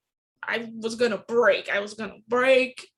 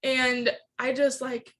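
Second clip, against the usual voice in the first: ordinary talk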